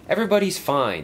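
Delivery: cheerful all-clear; cheerfully. A narrator's reading. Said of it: "said Hank cheerfully".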